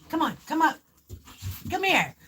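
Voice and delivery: real high-pitched summons to a pet; calling a dog. Real, not acted. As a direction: coax a reluctant dog up with short high calls. Calling her reluctant dog.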